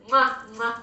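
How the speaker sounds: two loud smacking kisses; between kisses. Real, not acted. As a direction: blow loud kisses at the camera. Blowing kisses to viewers.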